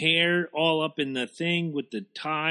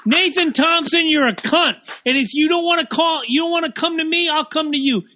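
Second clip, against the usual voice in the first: comic rage aimed at one listener, much higher and louder; mock furious rant; 10 semitones higher, 4 dB louder than usual. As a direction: yell it like a threat you half mean.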